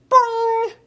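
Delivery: loud, high springy boing call; a frog's loud twanging boing. A narrator's reading. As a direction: twang it like a frog.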